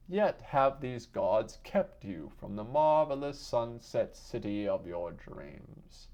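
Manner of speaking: ordinary delivery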